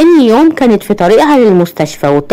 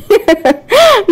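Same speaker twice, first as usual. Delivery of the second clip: very high short laugh; ضحكة; 12 semitones higher than usual. A narrator's reading. Character laughs in banter. (ضحكة عالية قصيرة)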